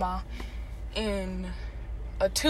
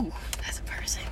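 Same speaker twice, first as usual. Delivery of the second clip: whisper; 5 semitones lower, 10 dB quieter than usual. Real, not acted. Getting emotional over poor childhood.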